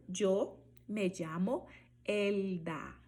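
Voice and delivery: yo me llamo Elda slowly, L as U-like sound; slow, stretch the L in Elda; Spanish L. Shown as a demonstration. Teacher modeling Spanish self-introduction. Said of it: "Por exemplo".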